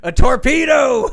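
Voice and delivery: loud, high laughing shout. Retold or imitated. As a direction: blurt it out laughing. Excited shout mid-story.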